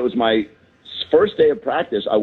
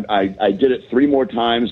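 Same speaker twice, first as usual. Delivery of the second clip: ordinary talk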